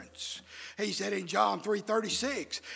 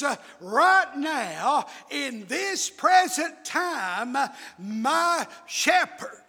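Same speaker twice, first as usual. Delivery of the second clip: raised, stretched-out preaching cadence; emphatic preaching; 4 semitones higher, 6 dB louder than usual. Real, not acted. Preacher assuring a friend of his shepherd, emphatic preaching.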